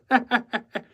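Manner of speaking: high, joyous cackle; joyous laugh. Acted. Decorator amused at his own story, joyous laugh. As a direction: laugh out loud with delight. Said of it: "He laughed joyously".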